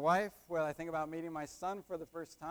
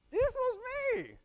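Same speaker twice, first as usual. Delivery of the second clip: "Jesus loves me" in a much higher, chanted mock echo of the crowd; mimicking the congregation; 5 dB louder than usual. Preacher mimics the congregation's answer of "Jesus loves me", much higher and mocking. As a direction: parrot their answer back, high and sing-song. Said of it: "But you didn't say Jesus loves Tim".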